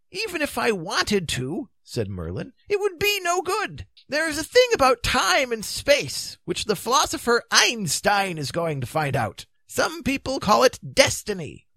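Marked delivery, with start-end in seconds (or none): 0.1-1.7 high-pitched wizard voice; high, theatrical Merlin voice; Merlin, matter-of-fact. Acted. Merlin answers Arthur in character. 2.7-11.7 high-pitched, animated wizard storyteller; high, theatrical Merlin voice; Merlin telling a grim tale. Acted. Merlin explains in character.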